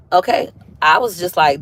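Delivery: ordinary talk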